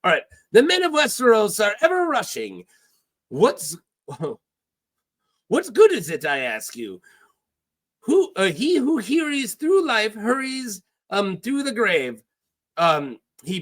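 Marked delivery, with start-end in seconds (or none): 0.5-12.2 high, swooping put-on accent; theatrical foreign pirate, stumbling over the lines; Salladhor Saan. Pirate chides Davos for rushing, in a sing-song accent. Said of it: "started going to Salah's dialogue".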